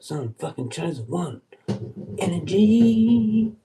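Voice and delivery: loud show-off flirting; cocky mock flirting.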